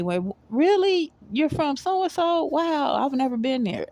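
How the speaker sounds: high-pitched, wowed reaction to hearing where they're from; amazed reaction. Retold or imitated. Replaying own response to a new acquaintance's homeland, amazed.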